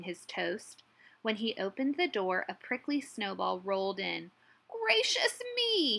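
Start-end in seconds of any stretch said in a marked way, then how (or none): 4.7-6.0 high, drawn-out astonished exclamation; surprised. A narrator's reading. Badger finding a prickly snowball indoors, surprised. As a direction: gasp it out high and slow, amazed. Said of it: "he said in surprise".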